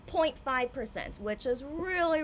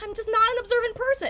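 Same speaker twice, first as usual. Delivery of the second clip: ordinary delivery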